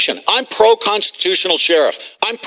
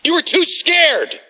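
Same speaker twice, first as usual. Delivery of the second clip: angry shout; 8 semitones higher, slower than usual. Real, not acted. Angry rant against officials.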